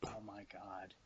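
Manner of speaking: very low and soft, appalled; hushed dismay. Retold or imitated. Friend hearing her head hit the floor, hushed dismay. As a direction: sink into a quiet mutter.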